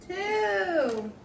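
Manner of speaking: high, drawn-out callback. Pretend. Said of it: "Say it louder".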